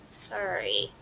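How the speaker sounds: sincere apology. A narrator's reading. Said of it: "And this time she meant it".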